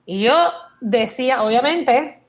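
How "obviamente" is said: ordinary talk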